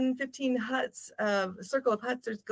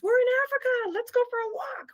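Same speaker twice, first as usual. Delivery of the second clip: lightly voiced eager friend, much higher; mock Scottish guy; 13 semitones higher, faster than usual. Scottish friend suggesting a night walk, lightly mocked and eager. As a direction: pitch it up like a keen mate at 2 a.m. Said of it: "the Scottish guy goes".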